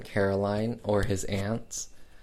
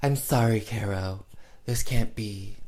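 Faye Dunaway as Barbara. Retold or imitated. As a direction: draw each phrase out with unvarying attitude. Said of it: "one attitude. It's always very".

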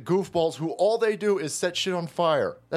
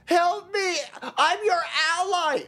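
much higher, mock-crying plea for help; whimpering protester; 13 semitones higher than usual. Protester begging the Islamists for help, hammed-up high crying whine. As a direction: whine like a scared kid begging a bigger kid for protection. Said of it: "and he goes".

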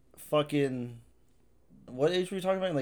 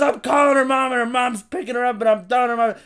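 high, loud mom laying down the law; hammed-up threatening mother; mock angry mom threat; 9 semitones higher, 5 dB louder than usual. Mom threatening to call the girlfriend's mom. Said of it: "She goes".

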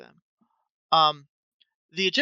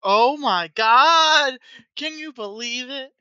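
excited; 7 semitones higher, 6 dB louder, slower than usual. Real, not acted. Excited reaction to episode.

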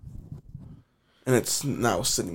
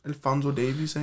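mock Canadian accent; 2 semitones higher than usual. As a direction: tease him in a lightly put-on Canadian accent. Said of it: "What the fucking accent is that".

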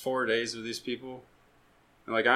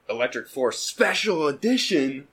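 higher, louder and slow, like a grand title announcement; announcer voice; 6 semitones higher, 7 dB louder than usual. Pretend. Host joking about the episode name, in a grand announcer voice. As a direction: boom it out like a trailer voiceover.

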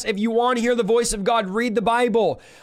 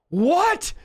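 high, rising disbelief; a startled, high-pitched double take; astonished; 8 semitones higher than usual. Retold or imitated. Preacher reacting to the verse he opened to, astonished.

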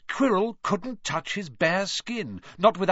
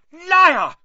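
furious shout, higher and much louder; 12 dB louder than usual. A narrator's reading. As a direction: hurl it at the villain. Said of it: "Harry shouted suddenly".

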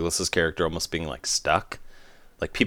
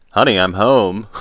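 mock dad greeting; 5 semitones higher, 9 dB louder than usual.

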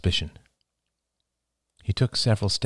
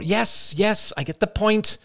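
impatient; 14 semitones higher than usual. Acted. Mother cuts son off.

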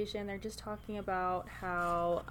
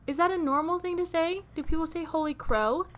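high, loud incredulous questioning; baffled disbelief; 9 semitones higher, 7 dB louder than usual. Real, not acted. Reader questioning co-host about odd slang, high and incredulous. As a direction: pitch it way up, baffled by the phrase.

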